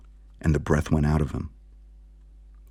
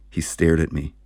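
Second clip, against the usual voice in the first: ordinary delivery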